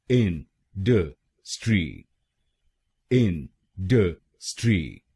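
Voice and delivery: इन ड स्ट्री said slowly, each part apart, इन stressed; slow spelled-out इन ड स्ट्री; syllable by syllable. Shown as a demonstration. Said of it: "इस शब्द में तीन शब्दांश हैं".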